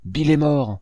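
startled announcing cry; cried out. A narrator's reading. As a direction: call it out from inside the house. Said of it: "une voix cria dans la maison".

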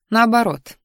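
ordinary delivery

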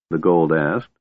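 ordinary delivery

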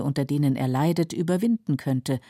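ordinary delivery

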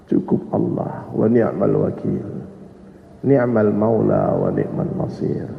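low, soft, slowly drawn-out recitation; hushed reliance on God, unhurried; Ibrahim's calm answer. Acted. Nabi Ibrahim declines Jibril's help, low, slow and calm. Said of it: "Apa jawaban Nabi Ibrahim?".

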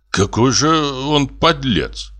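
indignant grumble. A narrator's reading. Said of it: "проворчал Груздев, негодуя".

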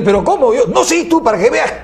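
shocked squeal of disbelief; surprised witch doctor. Acted. Witch doctor reacts to the husband, high laughing surprise. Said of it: "Le dice".

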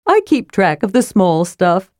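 lightly voiced cheerful sidekick; a hint of a bouncy little character; Tass speaking. A narrator's reading. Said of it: "said Tass".

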